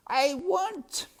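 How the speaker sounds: very high, loud wail; crying out. A narrator's reading. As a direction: cry out with desperate longing. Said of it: "cried Meg".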